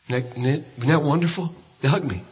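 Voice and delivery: ordinary talk, nothing marked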